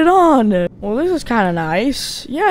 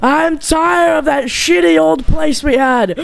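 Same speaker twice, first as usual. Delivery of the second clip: excited shouting; 5 semitones higher than usual. Acted. Character thrilled by the new home, excited shouting. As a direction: shout with delight.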